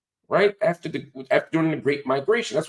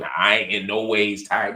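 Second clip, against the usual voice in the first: lightly mimicked bad Southern church preacher, low and drawn out; Hillary Clinton; 8 semitones lower than usual. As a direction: drag it low in a mock Southern drawl. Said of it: "So Hillary sounds crazy".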